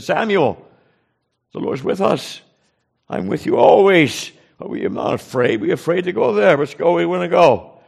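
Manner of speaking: retold rallying cry, pitched high with conviction; urgent rallying. Rallies a fearful companion to go.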